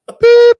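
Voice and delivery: loud, very high held tone; voicemail beep. Retold or imitated. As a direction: imitate an answering machine beep. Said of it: "all of a sudden you hear this".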